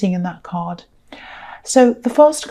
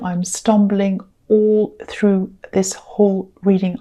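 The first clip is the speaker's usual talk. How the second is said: ordinary talk, nothing marked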